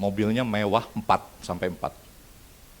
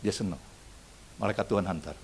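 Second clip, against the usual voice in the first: ordinary talk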